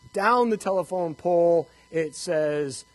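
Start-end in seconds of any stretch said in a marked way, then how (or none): none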